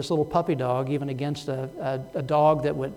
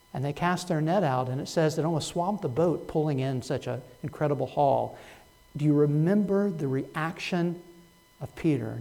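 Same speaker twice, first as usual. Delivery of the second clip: ordinary talk, nothing marked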